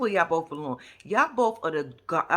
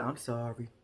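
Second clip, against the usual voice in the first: mock sorry; 9 semitones lower, 8 dB quieter than usual. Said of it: "Here goes the".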